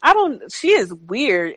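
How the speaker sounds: ordinary talk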